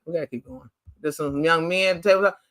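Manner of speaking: ordinary talk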